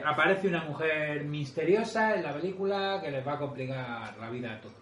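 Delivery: usual speaking voice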